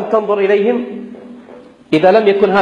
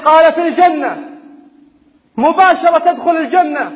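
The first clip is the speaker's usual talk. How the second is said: raised, insistent sermon voice; fiery preaching; 9 semitones higher than usual. Acted. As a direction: preach it with rising fervor. Rousing sermon to fighters.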